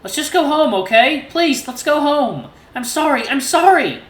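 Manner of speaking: high, slow sobbing begging; sobbing plea. A narrator's reading. Son sobbing on Dad's leg, begging to go home. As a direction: sob it out, clinging to him. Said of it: "I begin sobbing on his pant leg".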